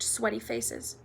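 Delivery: ordinary delivery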